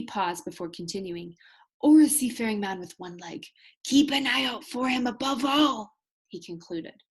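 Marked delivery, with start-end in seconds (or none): 1.8-6.0 urgent warning. Acted. Captain's final warning.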